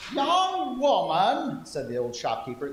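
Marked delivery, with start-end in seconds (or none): 0.1-1.7 high, very slow grandma voice; old lady voice. Acted. Old shopkeeper greeting the scared girl in grandma voice. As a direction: croon it like a little old shopkeeper. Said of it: "That's my old lady voice".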